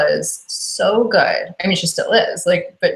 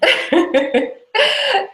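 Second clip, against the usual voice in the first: high laugh. Real, not acted. Delighted laugh about Vrax.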